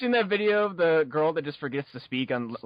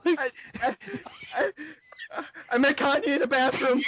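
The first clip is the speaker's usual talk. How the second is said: higher, louder panicked blurt; blurted shout; 9 semitones higher, 4 dB louder than usual. Pretend. Tongue-tied fan blurting nonsense.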